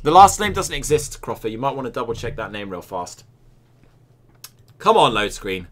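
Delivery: ordinary delivery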